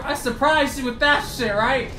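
usual speaking voice

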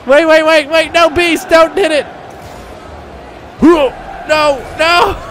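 frantic high-pitched gamer yelling; excited panic. Real, not acted. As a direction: shout urgently at a teammate to stop. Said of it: "Oh, I got excited".

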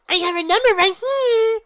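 very high, loud bubbly pony voice; Pinky, exclaiming. Acted. Pinky introduces herself to the gym leader, very high and bubbly. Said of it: "Pinky exclaimed confidently".

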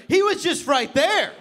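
high, loud yell; frantic, hammed-up insistence; hysterical insistence. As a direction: shout it, desperate to be believed. Insists while pointing at empty bed.

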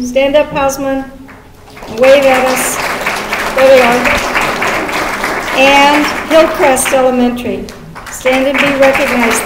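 loud cheering call-out; big crowd shout-out. Real, not acted. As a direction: rouse the audience. Calling school to stand.

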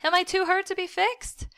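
high, pleading, rising question; desperate. A narrator's reading. Bleeding boy asking his mother, desperate. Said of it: "I asked desperately".

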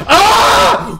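loud, very high shriek; sudden piercing cry; scream. Real, not acted. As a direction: scream as you get hit. Killed in the game.

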